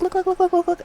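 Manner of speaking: high rapid-fire mock urging; high, repetitive badgering; pushy girl imitation. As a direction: imitate her pestering in a high voice. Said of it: "And she's like".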